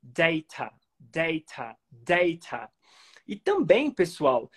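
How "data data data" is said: "data" as DAY-ta with a clear t; as transcribed. Shown as a demonstration. Teacher reads the phonetic transcription, clear t. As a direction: say "data" slowly, "day" then a crisp ta. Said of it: "como tá aí na transcrição fonética".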